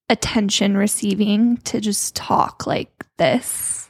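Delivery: slow, drawn-out attention-seeking talk; dragged-out words. Shown as a demonstration. Showing co-host how some girls talk, dragging out the words. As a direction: drag each word out on purpose. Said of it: "drag out their words".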